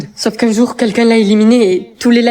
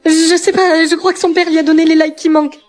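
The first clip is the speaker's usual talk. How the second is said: panicked; 6 semitones higher than usual. Acted. Panicked news of the demon.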